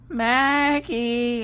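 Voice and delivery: high, drawn-out mommy call; sing-song calling. Retold or imitated. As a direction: call the child in a careful singsong. Mother calling child sweetly.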